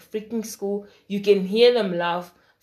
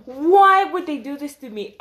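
higher, louder, fed-up complaint; exasperated outburst; 6 semitones higher, 7 dB louder than usual. Retold or imitated. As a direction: throw the question up in disbelief.

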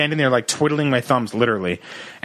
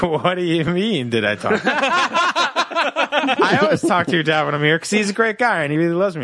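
laughing denial; 5 semitones higher than usual. Retold or imitated. To his girlfriend, feigning innocence while laughing. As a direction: play dumb while cracking up.

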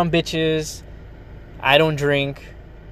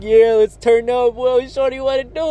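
hyped party line; 8 semitones higher than usual. Retold or imitated. Mocking club lyrics he'd never write, high hype voice. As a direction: ham up a club rapper hollering at a girl. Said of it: "I'm never like".

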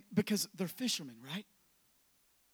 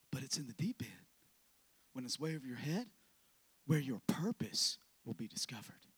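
breathy, intimate preacher's whisper; soft, low, secret-sharing whisper; hushed sermon whisper; 7 semitones lower, 9 dB quieter than usual. Acted. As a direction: lean in and whisper it to the room. Whispered hush over the deep end.

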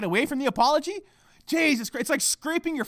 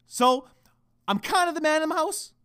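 ordinary talk

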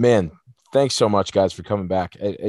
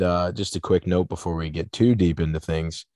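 ordinary talk, nothing marked

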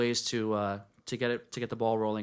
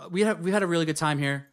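normal talking voice

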